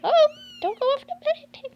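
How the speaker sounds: high, loud alarmed cry; alarmed call. Real, not acted. Owner crying out as the puppy nears the bed edge, high and loud. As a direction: call out fast to stop the puppy falling.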